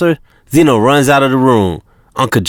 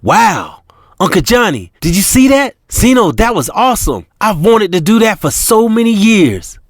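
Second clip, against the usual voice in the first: put-on high, thrilled young woman's voice; China thrilled after the pimp is beaten; juiced up; 9 semitones higher than usual. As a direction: gush with excitement, voice up high. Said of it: "China is juiced up".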